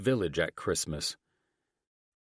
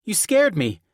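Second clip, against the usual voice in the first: put-on high, loud child voice; startled girl; 13 semitones higher, 9 dB louder than usual. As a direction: protest in a high voice, just given a fright. Startled daughter scolds dad.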